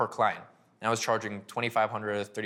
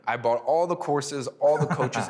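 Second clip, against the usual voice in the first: laughing; 4 semitones higher, 6 dB louder than usual. Real, not acted. Laughing at wasted coaching money.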